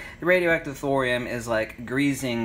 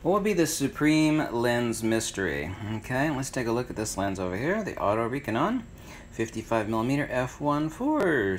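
usual speaking voice

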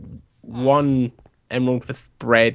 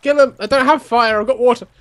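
high, raised voice; panicked urgency; 9 semitones higher, 4 dB louder than usual. Real, not acted. Player replying during creeper attack, high and urgent. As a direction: answer urgently mid-fight.

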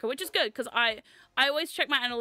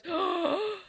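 imitated shocked gasp; 6 semitones higher than usual. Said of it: "someone goes".

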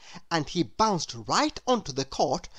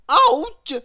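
much higher, loud yelp; sharp cry of pain; 10 dB louder than usual. Acted. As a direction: yelp as the head hits. Bangs head on ceiling.

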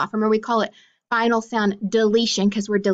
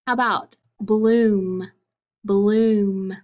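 ordinary talk, nothing marked

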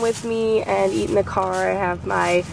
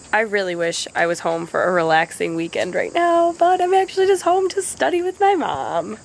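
usual speaking voice

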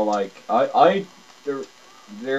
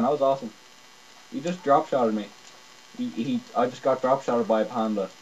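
ordinary delivery

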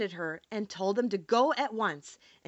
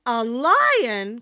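loud cry of alarm; little queen's startled outburst; 9 dB louder than usual. A narrator's reading. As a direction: cry out in shock, much louder. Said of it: "cried the little queen".

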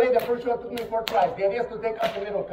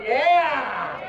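excited; 7 semitones higher than usual. Acted. Hyped performer.

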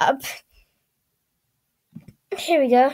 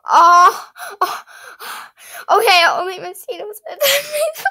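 laugh-crying; 6 semitones higher, 3 dB louder than usual. Real, not acted. Moved by pet's description.

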